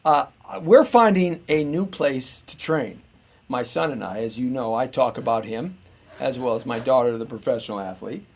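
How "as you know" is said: normal talking voice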